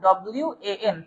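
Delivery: ডব্লিউ এ এন spelled softly, letter by letter; spelled-out pronunciation. Shown as a demonstration. Teacher showing students the sound of one, softly spelled. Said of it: "এটার উচ্চারণ মূলত এরকম".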